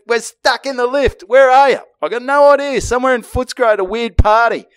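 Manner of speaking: high, urgent mock plea; retold frantic call. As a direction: imitate calling for help from a stuck lift. Retells emergency call from lift.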